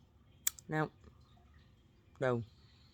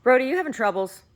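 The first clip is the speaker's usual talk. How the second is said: higher, louder call to the dog; 12 semitones higher, 10 dB louder than usual. Real, not acted. Owner calling out to her dog Brody, bright and loud.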